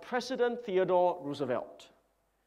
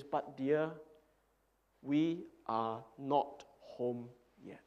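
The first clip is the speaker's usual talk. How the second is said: soft, slow, lower comfort; wife gently calming her upset husband; gentle wife; 3 semitones lower, 7 dB quieter, slower than usual. Retold or imitated. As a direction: hold him close and soothe. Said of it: "very wisely and very gently".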